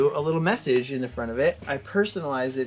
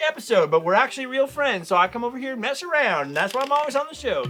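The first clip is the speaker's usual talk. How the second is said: host announcer voice; 10 semitones higher than usual. Acted. Announces B-roll.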